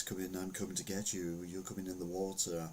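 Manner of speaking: hushed, menacing voice of Death; scary Death voice. Retold or imitated. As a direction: softly threaten like Death itself. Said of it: "speaking in a, in a scary voice".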